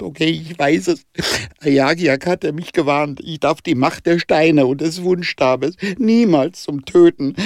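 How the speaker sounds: high, pleading confession; desperate. Acted. Jonah confessing his mistake to Danica, high and desperate. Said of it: "sagte er verzweifelt".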